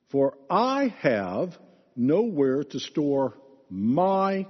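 slow reading that leans hard on every "I" and "my"; emphasized scripture reading. Shown as a demonstration. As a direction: read it slowly, punching each "I" and "my" to expose the self-focus. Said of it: "I'm going to try to emphasize certain words".

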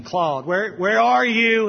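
rising loud call of a name; God calling. Acted. God calling Claude in the garden, rising call. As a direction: call out, searching for him.

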